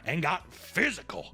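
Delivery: normal talking voice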